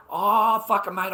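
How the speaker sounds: loud exasperated outburst. Retold or imitated. Retelling the chat, loud disgusted outburst. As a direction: throw it out in disgust.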